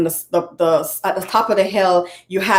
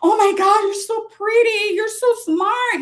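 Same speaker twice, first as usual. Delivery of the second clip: put-on much higher, sing-song baby praise; cooing to a baby; 13 semitones higher than usual. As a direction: gush over a toddler. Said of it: "we hold them and we're like".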